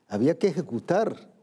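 ordinary delivery

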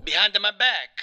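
wronged Italian husband, hammed up; Mario with Italian accent. Mario confides his brother's betrayal, in a hammed-up Italian accent. Plays Mario being interviewed.

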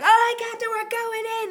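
high, loud mock enthusiasm; eager crew. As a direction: shout up to the captain like keen rookies. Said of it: "they're all like".

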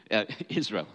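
a quick laugh at his own slip, then Israel; chuckling self-correction. Real, not acted. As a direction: laugh off the misspoken word.

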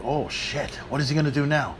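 nervous fan, hushed and uneasy; hushed awe. Retold or imitated. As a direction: murmur it like you're watching a horror movie. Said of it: "And I'm like".

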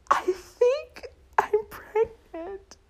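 slow, very high tearful wail; hammed-up crying confession; mock sobbing. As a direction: squeak it out through pretend sobs. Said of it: "and I start crying".

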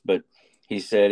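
normal talking voice

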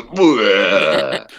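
loud pretend retch, falling away; mock throwing up. Everyone throwing up at hearing Zed, mock retch. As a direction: fake a sudden vomit. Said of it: "programmed everyone to throw up at Zed".

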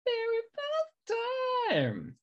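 sing-song echo of her own words; playful echo. Acted. Host echoing the intro line in a high, slow mock voice.

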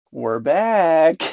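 a cheesy Southern twang, pushed up and loud; overdone Southern accent declaring a comeback; bad Southern accent. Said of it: "That was a really bad Southern accent".

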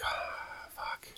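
breathy dismayed whisper, very soft; whispered oh fuck. Retold or imitated. Whispering in dread at the cops at the door. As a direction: mutter it under your breath in dread. Caught by huge policemen, whispered.

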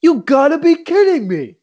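put-on high, loud incredulous old coach; mock Lou Holtz. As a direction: imitate a coach in disbelief.

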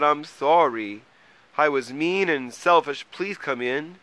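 high, loud apology; apologetic pleading. A narrator's reading. Mole begs wet Troll to come in, apologetic. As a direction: plead loudly to make amends.

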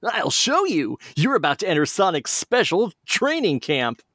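high, bright-pitched hero voice; Sonic voice. Acted. Sonic turning to the fox who called him, high cartoon hero voice. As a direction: answer like a cocky cartoon hero. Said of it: "Sonic speaking".

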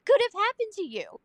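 high-pitched mock exasperation; exasperated. Mom to her screaming son, exasperated. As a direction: demand answers in playful disbelief.

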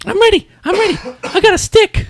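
put-on very high, loud little-kid voice; excited kid bursting to go, squeaked high; eager small child. Child insists she is ready for a first mission, eager high kid voice.

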